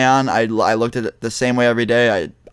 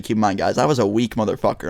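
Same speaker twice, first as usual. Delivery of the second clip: usual speaking voice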